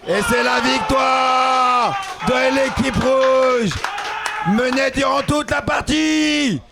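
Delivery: slow, announcer victory call; esports caster. Real, not acted. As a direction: proclaim the win to the crowd.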